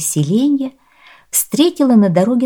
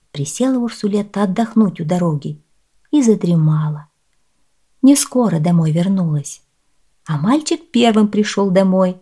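usual speaking voice

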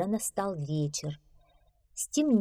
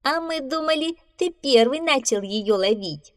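smirking; 8 semitones higher, 4 dB louder than usual. A narrator's reading. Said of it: "усмехнулась белка".

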